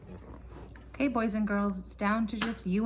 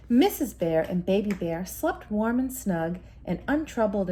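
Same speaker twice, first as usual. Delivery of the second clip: story narration; 3 semitones lower, 6 dB louder than usual. A narrator's reading. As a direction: tell it like a bedtime story to a child. Bedtime story narration continues.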